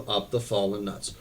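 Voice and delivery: ordinary delivery, nothing marked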